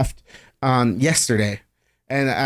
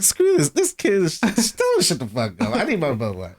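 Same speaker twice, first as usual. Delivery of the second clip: put-on grumpy old dad.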